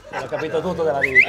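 very loud, fast shout. Acted. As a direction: yell it out.

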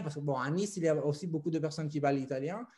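normal talking voice

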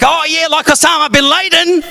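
high-pitched mock Aussie voice; broad Australian accent. Aussie reacting to newcomer's name, high mock Australian accent. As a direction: imitate an Australian, pitched right up. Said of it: "compared to their accent".

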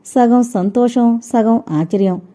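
ordinary delivery, nothing marked

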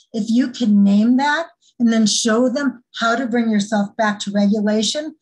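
usual speaking voice

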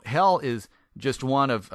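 ordinary talk, nothing marked

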